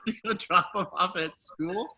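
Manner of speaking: ordinary delivery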